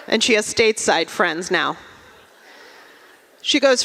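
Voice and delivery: normal talking voice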